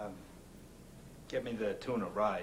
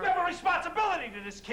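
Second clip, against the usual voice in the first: angry; 5 dB louder, faster than usual. Acted. Heated argument over the kid.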